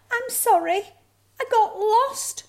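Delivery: very high, loud little cub; apologetic cub. A narrator's reading. Cub apologises to mother, high and sorry. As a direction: apologise in a squeaky-high child voice.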